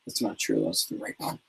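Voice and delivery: soft confiding whisper to the audience; whispered aside. Pretend. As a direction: whisper it like a little confession. Confessing aside to viewers.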